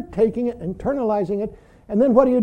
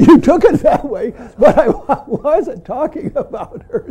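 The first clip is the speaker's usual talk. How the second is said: chuckling through the words, louder; amused laugh breaking into the line; 5 semitones higher, 6 dB louder than usual. Real, not acted. Teacher laughing at a student's mix-up, chuckling aloud.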